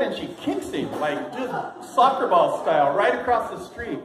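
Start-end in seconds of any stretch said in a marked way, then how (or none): none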